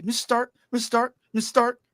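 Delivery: mock boy hero. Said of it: "don't have to hear him say".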